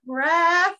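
high, loud appeal to the ref; calling the ref. Retold or imitated. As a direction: cry out for the referee. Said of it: "I was just like".

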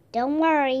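plain reading voice

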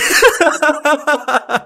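giddy celebratory laughter; elated laughing; joyful high laugh. Real, not acted. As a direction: laugh out in triumph. Celebrating win.

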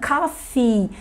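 "coffee" lento, com i final longo. Shown as a demonstration. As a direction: segure o i no fim de "coffee". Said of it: "é o i longo". ("coffee" com o "ee" alongado)

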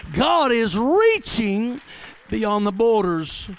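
drawn-out and loud, each word weighted; slow preacher emphasis; emphatic slow. Acted. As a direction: lean hard on reaching and borders. Slow emphatic sermon line.

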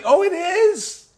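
very high, loud, slow prissy reply; mock smug teacher.

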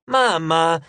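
a daughter begging her mother to stop; exasperated protest. A narrator's reading. Piper embarrassed by her mother. ("Mamma!" esasperato)

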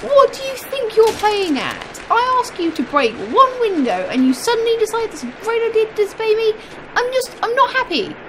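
put-on furious parent telling off a child; angry scolding. Scolding Larry the game character.

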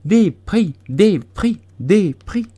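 high, loud crowd chant; punchy repeated cries, pitched high; tumultuous shouting. A narrator's reading. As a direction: clamour for it like an excited crowd. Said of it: "en criant tumultueusement".

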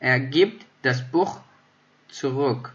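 slow, spaced German; slow model reading. Shown as a demonstration. Teacher to learners, slow and spaced example sentence. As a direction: pace it out word by word. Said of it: "teacher reads example sentence".

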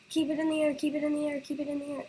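rapid repeated urging, high; willing the ball to stay up; urgent pleading. Real, not acted.

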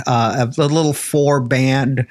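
usual speaking voice

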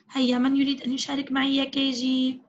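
normal talking voice

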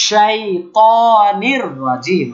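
very slow শাইতানির রাজিম with a full-mouth sh; spread sh sound. Shown as a demonstration. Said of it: "দেখেন শাই".

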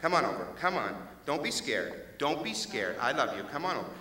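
soft, low coaxing; coaxing dog. Acted. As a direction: gently lure the scared dog back. Man coaxing dog softly.

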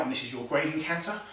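usual speaking voice

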